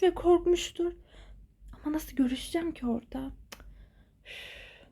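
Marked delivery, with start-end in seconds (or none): 1.7-3.3 soft worried whisper. Acted. Friend wondering how to reach Aylin, whispered. Girl worrying to herself. 4.2-4.9 breathy exasperated huff; weary sigh. Acted. Friend alone after bad news, tired huff.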